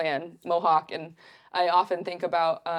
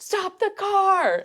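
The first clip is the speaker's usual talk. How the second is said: much higher, slow urgent cry; urgent call; 14 semitones higher, much slower than usual. Retold or imitated. Telling Jimmy to stop the car, much higher and urgent. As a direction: tell the driver to stop right now. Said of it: "and I was like".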